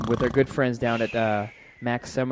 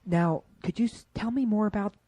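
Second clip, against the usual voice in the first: higher, faltering beginner's question; mock baffled student; 7 semitones higher than usual. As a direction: play a clueless classmate, stumbling. Mimicking baffled beginners in the class.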